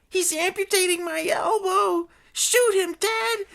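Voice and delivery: very high, hysterical wail; screaming. Acted. Bitten woman demanding the gang shoot, screaming hysterically. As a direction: scream it, drawing out the last words. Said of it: "screamed the woman".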